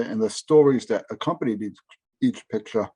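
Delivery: ordinary talk, nothing marked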